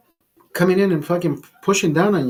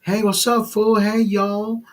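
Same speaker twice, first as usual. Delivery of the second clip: lightly mimicked street talk, pitched up; mock street slang; 6 semitones higher, slower than usual. Man mocking how everybody wants to talk, mock street slang. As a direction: imitate drawn-out street greetings. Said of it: "everybody wants to fucking talk the same way".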